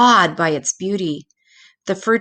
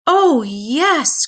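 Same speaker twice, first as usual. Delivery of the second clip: put-on much higher, gleeful child's yes; excited cry; 8 semitones higher, 3 dB louder than usual. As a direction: cry out in happy agreement. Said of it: "cried Peter".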